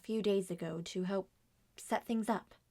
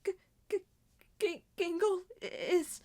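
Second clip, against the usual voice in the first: stammering in shock; 7 semitones higher, 3 dB louder than usual. Acted. Stammering out another death.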